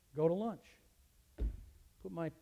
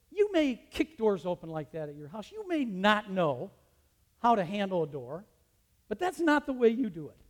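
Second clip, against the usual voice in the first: scolding lecture; 5 semitones higher, 10 dB louder than usual. Retold or imitated. Teacher lecturing a student about the door, loud scolding. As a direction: dress him down firmly. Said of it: "I'm talking to him like this".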